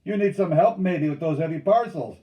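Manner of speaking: loud, high jeer; mocking call. A narrator's reading. Bellegarde jeers at the laden paddlers, calling out. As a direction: shout a teasing offer. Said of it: "he called out as they unloaded".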